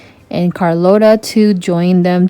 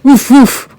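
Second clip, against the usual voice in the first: higher, loud barking woof; dog bark; 6 semitones higher, 5 dB louder than usual. A narrator's reading. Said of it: "Max barked".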